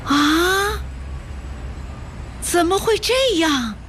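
high rising gasp, then a drawn-out question; astonished. A narrator's reading. Listener is handed the house deed, asks in astonishment. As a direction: ask in shock, voice pitched right up. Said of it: "他吃惊地问".

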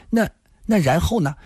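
put-on curious young woman. A narrator's reading. Granddaughter asking what follows, curious.

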